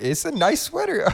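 high squeaky mock of the guy. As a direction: squeak it out like a teasing imitation. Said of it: "He's like".

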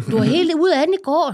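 a friend rubbing it in; teasing friend. Retold or imitated. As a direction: tease her like a gloating friend. Friend teasing next day.

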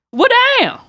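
high, loud well damn; shocked. Real, not acted. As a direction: react in shocked disbelief. Reaction to stingy date.

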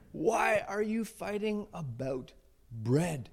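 very slow, drawn-out exasperation; frustrated. Acted. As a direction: space out each word as a worn-out rabbi. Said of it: "he's frustrated, he's worked up".

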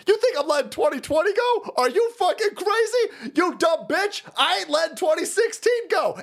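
high-pitched, worked-up tirade; indignant rant. Real, not acted. Host refusing to let twenty twenty go, indignant high-pitched rant. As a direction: fire back in outraged disbelief, pitch way up.

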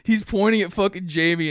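ordinary delivery

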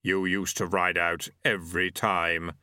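slow, low accusation from Death; accusing. A narrator's reading. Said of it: "said Death accusingly".

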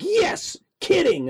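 high, loud burst of glee; excited exclamation. Retold or imitated. Neighbor reveals his secret, high and excited. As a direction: blurt it out with delight. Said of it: "And he's like".

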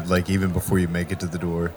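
ordinary delivery

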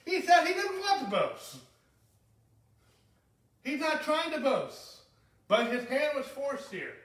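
fervent preacher's peak; impassioned preaching. Acted. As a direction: hammer home that Paul was not boasting. Preacher peaks in emphasis.

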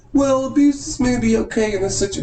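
high, squeaky mock-reasonable voice; mock apologist. Mocking an opinion he rejects.

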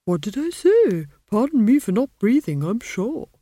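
huffy talking tree, rising; indignant old tree, taking offence; offended tree voice. A narrator's reading.

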